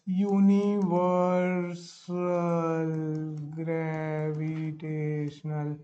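very slow, hushed, drawn-out syllables; writing aloud. Real, not acted. Teacher writing the law's name for students, slow and hushed. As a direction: stretch each syllable softly and low while writing. Sounding out words while writing.

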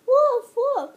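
loud high barking woof; dog bark imitation.

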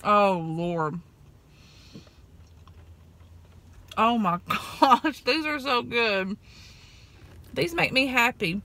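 talking between bites; chewing while talking. Real, not acted. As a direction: mouth partly full. Seen 2: Bit donut hole at -7; lips show chewing while she talks.

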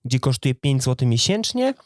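ordinary delivery

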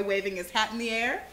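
usual speaking voice

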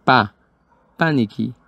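"pa" alone first, then "panicky" stressed on PA; "pa, panicky", the first syllable carrying the force. Shown as a demonstration. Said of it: "fuerza sobre la primera sílaba".

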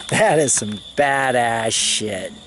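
low, drawn-out words with weight on each; slow, impressed verdict. Retold or imitated. Police officer rating what he just smoked, slow awe. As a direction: imitate a smoker savoring it slowly, voice dropped.

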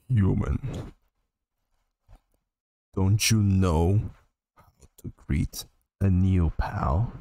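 low, slow skeleton voice, lazy and easy; a slow, low comic skeleton greeting; deep Sans voice. Acted. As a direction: drop into a deep, unhurried skeleton voice. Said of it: "wow, your deep voice".

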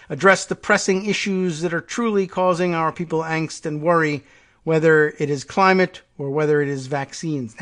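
reading a leader's speech aloud with a measured, declaiming tone; formal speech read. Acted. Reading the prime minister's climate speech to viewers, formal oratory. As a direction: deliver it like an address to world leaders.

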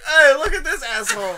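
high, loud mock heckle with a laugh; laughing imitation of a heckler; imitated jeer. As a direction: call out like a mocking bystander. Acts out people jeering at haircut.

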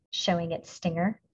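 usual speaking voice

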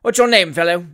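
booming, peremptory question; thundering. A narrator's reading. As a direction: thunder it from the bench. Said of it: "thundered Mister Nupkins".

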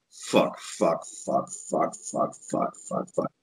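fading, hushed string of curses; muttered panic. Retold or imitated. Host panicking after calling the wrong fighter, fading muttered curses. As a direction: swear under your breath, dying away. Panicked cursing under breath.

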